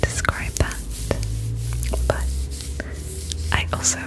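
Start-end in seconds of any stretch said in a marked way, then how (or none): none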